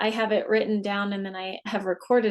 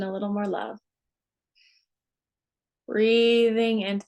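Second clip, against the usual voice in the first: guided meditation. Acted. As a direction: ease them down unhurriedly, phrase by phrase. Leads a guided relaxation.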